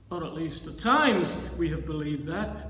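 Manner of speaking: usual speaking voice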